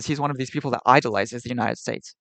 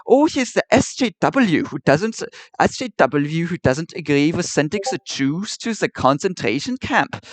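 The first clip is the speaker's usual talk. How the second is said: higher, slow sneering mockery of a Nazi; mocking Nazi voice; 4 semitones higher, slower than usual. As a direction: sneer it out slowly as a smug Nazi. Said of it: "and the Nazi says".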